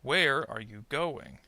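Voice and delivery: slow question, stress on "Where" and "going"; sentence stress. Shown as a demonstration. Teacher models sentence stress to repeat, slowly stressing "Where" and "going". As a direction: hit "Where" and GO in "going", light "are you". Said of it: "stressed syllable in the content words".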